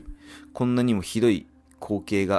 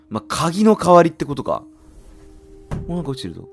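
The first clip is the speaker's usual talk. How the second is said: usual speaking voice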